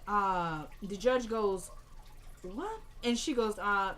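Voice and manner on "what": soft, high rising disbelief; acted shock. Judge hearing a surprise confession, stunned.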